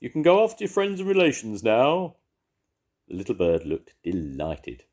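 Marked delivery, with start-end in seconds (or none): 0.0-2.2 high, loud owl voice. A narrator's reading. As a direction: cheer the bird off to its family. Owl calls to bird.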